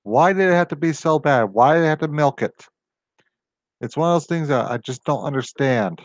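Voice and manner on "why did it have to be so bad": usual speaking voice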